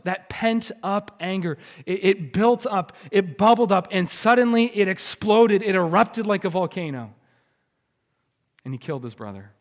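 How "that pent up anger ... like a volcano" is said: building, louder preacher's intensity; impassioned preaching. Real, not acted. Pastor describing anger erupting like a volcano, impassioned. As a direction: let the sermon swell and burst.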